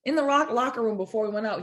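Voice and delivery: ordinary talk, nothing marked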